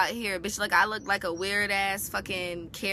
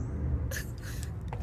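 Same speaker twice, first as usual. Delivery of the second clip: breathy laugh; 6 dB quieter than usual. Real, not acted. Laughing at own story.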